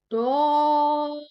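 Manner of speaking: दौद full and thick; heavy daad. Shown as a demonstration. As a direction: say दौद from the tongue sides, heavy. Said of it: "मोटा अदा करना है".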